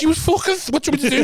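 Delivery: mock her in a high voice. Imitating the woman, high pitch.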